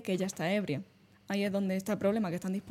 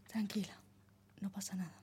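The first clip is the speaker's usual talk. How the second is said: hushed, soothing near-whisper, much softer; reassuring whisper; 10 dB quieter than usual. Shown as a demonstration. Man murmuring reassurance to a drunk girl, soothing whisper. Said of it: "intentando traer una presencia tranquilizadora".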